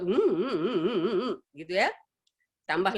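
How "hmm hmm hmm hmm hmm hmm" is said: mock warning hums with a wobbling pitch; glaring warning hum. Mother glares at interrupting child, imitated warning hums. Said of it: "Ibu langsung melotot".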